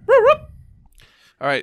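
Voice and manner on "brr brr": very high, loud siren buzz; bullhorn siren. Retold or imitated. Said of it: "bullhorn impression".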